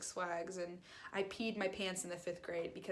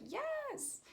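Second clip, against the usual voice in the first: much higher, eager little yes; greeted upstairs, nervous high yes; squeaky high yes; 3 dB quieter than usual. Retold or imitated. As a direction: squeak it out relieved. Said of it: "And I was like".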